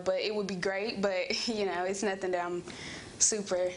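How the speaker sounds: usual speaking voice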